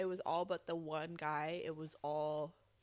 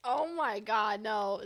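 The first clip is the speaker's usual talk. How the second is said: loud protest; 3 semitones higher, 8 dB louder, much slower than usual. Real, not acted. Embarrassed outburst.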